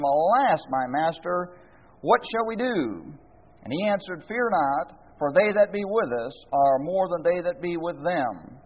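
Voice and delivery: steady, formal King James reading voice; scripture reading. Acted. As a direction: read the passage aloud to the congregation. Reads a long Bible passage.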